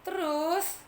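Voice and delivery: ordinary delivery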